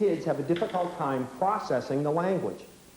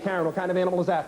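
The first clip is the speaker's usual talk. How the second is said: rapid-fire quiz question; breakneck pace; faster than usual. Shown as a demonstration. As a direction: fire the question off fast, teacher drilling a class. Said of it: "moving at this breakneck speed".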